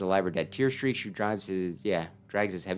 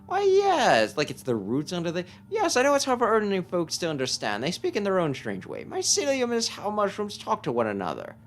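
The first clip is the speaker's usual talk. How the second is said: put-on eccentric old sage; 10 semitones higher than usual. Player voicing the strange old mushroom sage for the boy. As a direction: coo it like a batty hermit who hears mushrooms.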